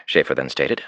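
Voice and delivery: ordinary delivery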